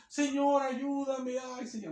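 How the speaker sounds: imagined timid cry for help; restrained plea. Shown as a demonstration. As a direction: plead meekly, letting it trail off. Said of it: "no decía como uno piensa a veces".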